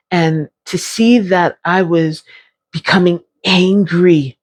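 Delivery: usual speaking voice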